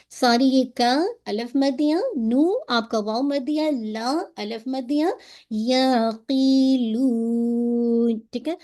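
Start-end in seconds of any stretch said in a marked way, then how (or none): 5.5-8.2 یعقلون very slow, the final madd stretched long; drawn-out recitation. Shown as a demonstration. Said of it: "مد العارض للسکون". (یعقلون میں مد عارض کو لمبا کھینچ کر)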